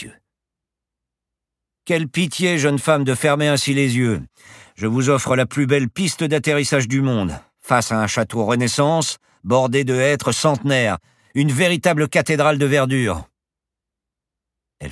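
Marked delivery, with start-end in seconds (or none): 1.9-13.3 high, loud, sweeping showman's pitch; boastful professor in full flourish; grandiloquent pilot. Acted. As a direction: declaim the splendour of the view. Pilot extols the landing view.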